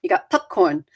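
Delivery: coastal Maine local giving directions; Maine accent. Shown as a demonstration. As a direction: put on a rambling Maine accent, R's dropped. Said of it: "Maine has a very different accent".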